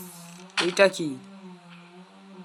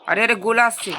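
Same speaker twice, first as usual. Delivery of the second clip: alarmed shout; 7 semitones higher, 9 dB louder than usual. Real, not acted. Cannonball incoming in game.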